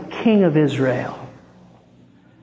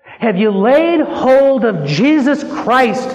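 raised, pleading pulpit prayer; impassioned preaching appeal; 5 semitones higher, 3 dB louder than usual. Acted. Preacher voices a sinner begging Jesus for redemption, impassioned. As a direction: cry out to Jesus with urgent conviction.

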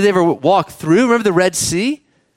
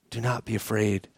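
much softer, lower, near whisper; hushed comfort; 8 semitones lower, 13 dB quieter than usual. Acted. Jesus to the disciples in the storm, hushed and tender. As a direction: let it fall to a tender hush.

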